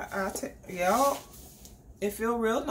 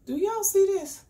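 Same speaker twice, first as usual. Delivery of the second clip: high, louder delighted call; excited exclaim; 12 semitones higher, 5 dB louder than usual. Real, not acted. Showing off perfume.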